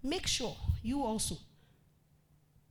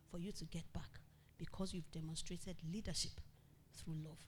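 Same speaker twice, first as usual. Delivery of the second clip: whisper; 4 semitones lower, 10 dB quieter than usual. Real, not acted. Sermon drops to whisper.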